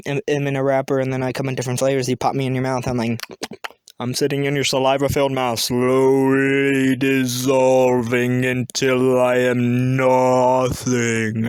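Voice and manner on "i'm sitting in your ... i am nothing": low voice stretching out extremely slowly; slow dissolving. Acted. Cough drop melting in a mouth, slow low drone. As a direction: drag the words as if melting away.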